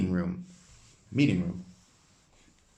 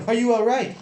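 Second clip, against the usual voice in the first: high worried companion; 12 semitones higher, 8 dB louder than usual. Acted.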